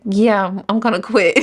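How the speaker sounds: amused, laughing at the end; laughing retold reply. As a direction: say it through a rising giggle.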